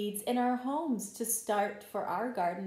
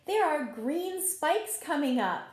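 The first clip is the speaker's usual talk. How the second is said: story narration; 5 semitones higher, 5 dB louder than usual. A narrator's reading. Teacher reading a garden story to children, gentle read-aloud. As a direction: tell the story like a picture book at circle time. Reads picture book to children.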